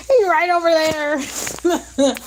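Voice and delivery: high, slow amused repeat; slow high amused emphasis; drawn out. Real, not acted. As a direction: stretch it out, half laughing.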